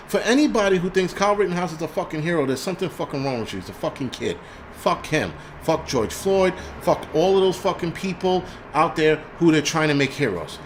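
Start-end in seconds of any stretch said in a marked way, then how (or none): none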